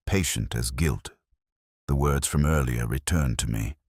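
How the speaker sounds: ordinary talk, nothing marked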